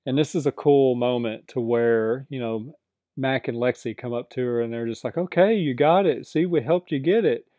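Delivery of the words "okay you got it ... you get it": higher, brighter self-satisfied voice; smug. Retold or imitated. Mac and Lexi come up to Daisy after her win, smugly pleased and higher. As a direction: act like you did them a favor.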